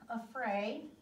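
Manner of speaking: ordinary delivery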